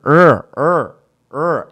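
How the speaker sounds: teacher isolating the "er" sound; drawn-out er sound. Shown as a demonstration. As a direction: hold the "er" and repeat it slowly. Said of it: "Isso aqui é um fonema".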